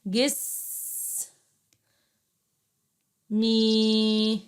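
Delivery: جس with a held hiss, pause, then long مي; syllable analysis. Shown as a demonstration. Teacher breaking words down for pupils, isolated syllables. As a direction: sound out جس and مي as separate syllables. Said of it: "حلل الكلمات".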